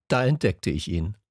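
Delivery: plain reading voice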